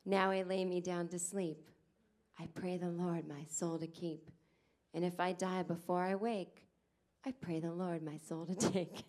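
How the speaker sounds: slow, low, solemn recited prayer; mom's bedtime prayer. Retold or imitated. Mother tucking her child in, slow solemn prayer. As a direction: intone it like a mother at bedside. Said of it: "she would tuck me in every night and go".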